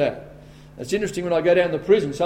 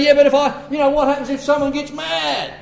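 worried objector; 8 semitones higher than usual. Retold or imitated. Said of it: "you say".

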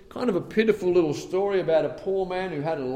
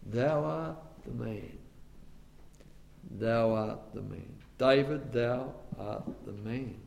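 low, soft grave accusation; God quietly naming the guilty; solemn divine verdict; 6 semitones lower, 7 dB quieter than usual. Acted. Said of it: "God has to come to us and say".

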